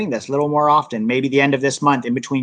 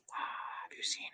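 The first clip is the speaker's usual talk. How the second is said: whisper; 24 dB quieter, much slower than usual. Real, not acted. Whispered tease before question.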